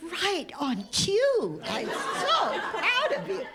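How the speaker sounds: high, laughing delivery; real laughter in a high voice; amused laughing praise. Real, not acted. Laughing over a well-timed interruption.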